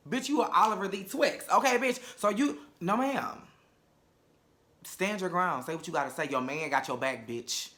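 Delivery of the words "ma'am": ordinary talk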